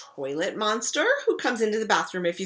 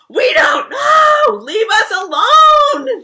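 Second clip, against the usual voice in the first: very loud, high, slow wailing yell; exasperated shout; 17 dB louder, much slower than usual. Acted. Siblings yelling at their pestering sister, loud wailing shout. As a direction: scream it fed up and desperate. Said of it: "they shout".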